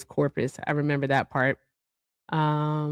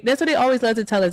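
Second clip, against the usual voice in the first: ordinary talk, nothing marked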